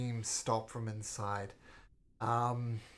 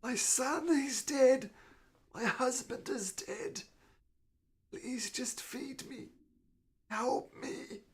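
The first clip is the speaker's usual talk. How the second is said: put-on high, weak old lady begging; frail shaky old whisper; 12 semitones higher, slower than usual. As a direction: beg feebly for food.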